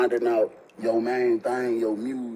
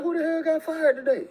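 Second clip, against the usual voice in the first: shocked yelp. Retold or imitated. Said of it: "I'm like".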